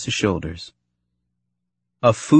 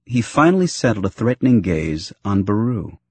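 ordinary delivery, nothing marked

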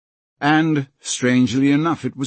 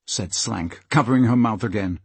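usual speaking voice

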